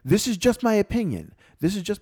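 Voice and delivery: high, loud mock of a critic; harsh, matter-of-fact. Student quoting his critic's opinion, harsh and matter-of-fact. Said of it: "saying it in a harsh way".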